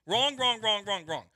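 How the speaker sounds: loud repeated wrong. Real, not acted. Host rejecting the manager's claim, loud and emphatic. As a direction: hammer down wrong, wrong, wrong.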